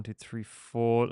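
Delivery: ordinary delivery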